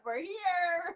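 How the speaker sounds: voice of gray hairs. Pretend.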